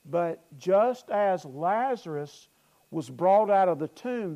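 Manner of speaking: usual speaking voice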